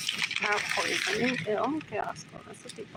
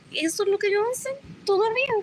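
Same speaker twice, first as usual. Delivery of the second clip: ordinary delivery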